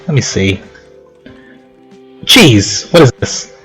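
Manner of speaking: ordinary talk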